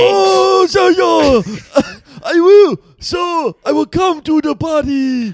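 high, shouted mock Korean accent. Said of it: "How's my Korean accent?".

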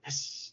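soft breathy hiss like a snake; mimicked snake hiss. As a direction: hiss it out quietly, like a snake striking. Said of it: "his Matrix snake dodge".

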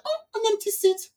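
high airy mock-innocent voice; mock innocent woman. Mimicking the stranger who took her seat, lightly voiced. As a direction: play the seat-stealer as breezily oblivious. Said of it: "she's like".

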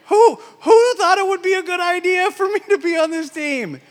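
high, loud, near-crying complaint; overwhelmed outcry. Retold or imitated. Overwhelmed new team lead asking who put him here, crying out high and loud. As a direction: cry out, overwhelmed and close to tears.

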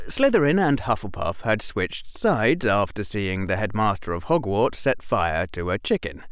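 ordinary delivery, nothing marked

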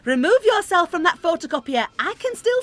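high sharp call across the room. Acted. Collector calling Lucas away from the photocopier. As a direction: call him out from afar.

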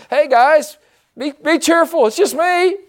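breezy high voice playing Jesus; casual Jesus. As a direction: toss it off like a buddy waving.

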